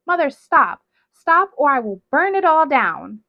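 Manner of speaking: loud, high threat shouted at her mother; threatening scream. A narrator's reading. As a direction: yell it, ready to burn everything. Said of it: "screamed at her mother".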